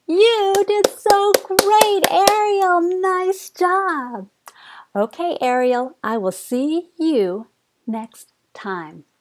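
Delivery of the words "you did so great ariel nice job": excited praise. Real, not acted. Final praise to child.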